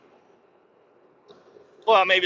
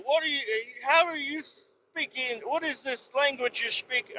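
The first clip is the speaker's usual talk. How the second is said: much higher, put-on voice, puzzled; baffled horseman; 13 semitones higher, 5 dB quieter than usual. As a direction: play the musket man, confused by your speech. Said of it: "And he's like".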